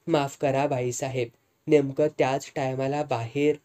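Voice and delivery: plain reading voice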